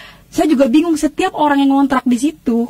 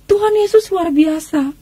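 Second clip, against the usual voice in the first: retold exclamation; 6 semitones higher, slower than usual. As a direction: exclaim it in drawn-out praise, higher pitched. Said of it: "dia langsung teriak di situ". (seruan pujian menirukan teriakan)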